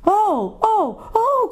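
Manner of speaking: high, anguished cries; little girl's high cry of grief. A narrator's reading. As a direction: wail it high in dismay. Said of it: "cried the two girls".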